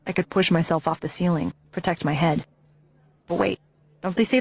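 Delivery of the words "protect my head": ordinary delivery, nothing marked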